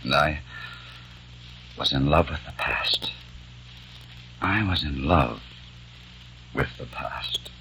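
soft, breathy whisper of regret; almost no voice, heartbroken; broken whisper. Acted. Peter whispers his loss after the family leaves, soft and broken.

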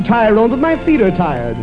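normal talking voice

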